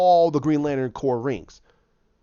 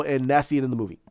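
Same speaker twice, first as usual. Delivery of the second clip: ordinary delivery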